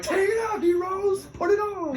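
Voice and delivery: high, strained mock yell; shouted order. Shipmates ordering him to wear the shirt, high shouted order. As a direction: bark it out like a hazer high above your voice.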